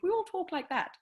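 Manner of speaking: high, loud put-on quote. Mocking Americans' idea of British speech.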